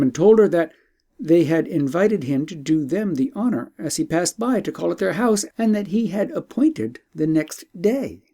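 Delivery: usual speaking voice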